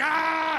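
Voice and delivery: loud, high open yell; drunken yell. Acted. Comic acting out a wild drunken night, loud high yell. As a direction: let out a sudden high cry.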